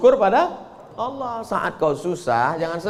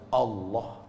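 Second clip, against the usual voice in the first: quiet calm reply; 7 semitones lower, 12 dB quieter than usual. Acted.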